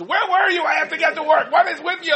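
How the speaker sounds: high, loud shrill-free scolding wife voice; hammed-up exasperated wife scolding; nagging wife. Wife scolds husband arriving late with baby, high loud nagging. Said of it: "she goes".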